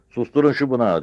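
high, loud command; captain snapping at his men; angry shouted order. A narrator's reading. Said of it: "diye bağırdı yüzbaşı". (öfkeyle bağırarak emreden)

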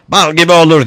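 high, loud retort; shouting. A narrator's reading. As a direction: fire back at full challenge. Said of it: "diye bağırdı Ringo".

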